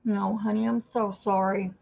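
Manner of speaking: normal talking voice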